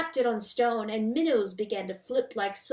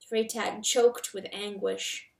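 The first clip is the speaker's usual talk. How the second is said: ordinary delivery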